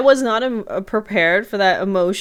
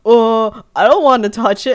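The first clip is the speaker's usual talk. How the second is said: loud, reluctant imitation; hammed-up squeamishness; mock squeamish refusal; 2 semitones higher, 7 dB louder than usual. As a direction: recoil from it with an exaggerated "oh".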